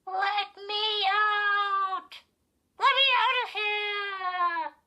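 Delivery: very high, drawn-out dummy cry; loud, squealing little voice pleading for release; tiny voice. A narrator's reading. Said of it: "she heard the tiny voice".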